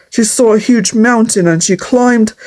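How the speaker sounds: ordinary delivery, nothing marked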